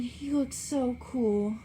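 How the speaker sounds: Daisy openly adoring Gatsby; Daisy's longing cry. A narrator's reading. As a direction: linger on each word, smitten. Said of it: "She cried".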